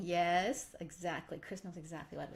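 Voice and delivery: ordinary delivery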